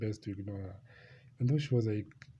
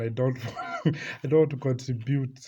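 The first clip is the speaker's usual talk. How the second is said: laughing; 2 semitones higher, 6 dB louder than usual. Real, not acted. Laughing at his own awkwardness.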